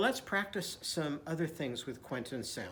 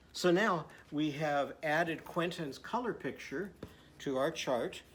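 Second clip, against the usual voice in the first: ordinary talk, nothing marked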